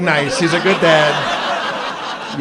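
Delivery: slow, drawn-out sarcastic approval; sarcastic praise. Retold or imitated. Comic mocking the bad dad, slow sarcastic nice. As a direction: praise him while meaning the opposite. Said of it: "I was like".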